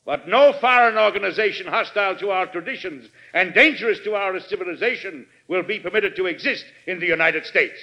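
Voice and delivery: measured oratory with rolling emphasis; stately speech to Congress; formal presidential address. Acted. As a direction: address a great assembly, pausing between phrases.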